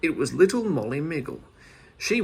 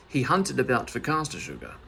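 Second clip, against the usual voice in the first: ordinary delivery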